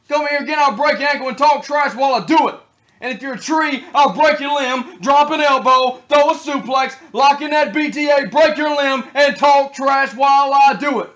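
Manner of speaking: loud, high trash-talk promo, slowed on key lines; wrestling promo threat. Acted. Wrestler threatening the fallen tree, loud and high. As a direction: growl threats like a heel wrestler.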